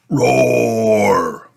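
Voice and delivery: a loud drawn-out toy roar. Acted. Toy dinosaur ending its song, loud roar. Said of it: "Dino Roar sings".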